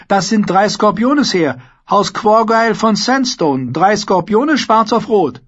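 high, loud squire's voice; boy proudly reciting heraldry; confident young squire. Acted. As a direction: correct him eagerly and respectfully.

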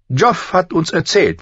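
a high, cold queen voice; lightly voiced haughty queen; evil queen. Queen speaks again.

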